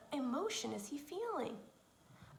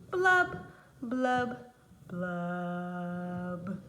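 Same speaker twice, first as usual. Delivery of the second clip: three blubs sinking lower each time; falling blubs; 5 semitones lower, 4 dB louder, much slower than usual. Acted. The pout pout fish ends his refrain with sinking blub sounds. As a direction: blow sad bubbles down the scale.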